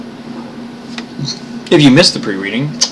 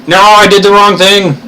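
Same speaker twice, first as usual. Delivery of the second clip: high, loud wail of a mistake; hammed-up panic over a wrong click; dismayed cry; 9 semitones higher, 6 dB louder than usual. Real, not acted. Mis-clicks, cries out.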